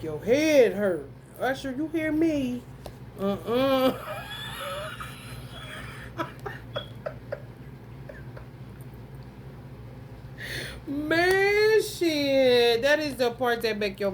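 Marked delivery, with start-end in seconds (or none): 4.0-10.8 big breathless howl of laughter; real, helpless laughter. Real, not acted. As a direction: lose it completely, laughing hard. Breaks up laughing at the line.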